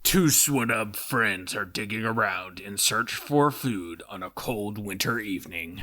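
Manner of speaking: muffled voice talking into a cup. Acted. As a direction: speak low into a cup. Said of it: "Why are you talking to a cup?".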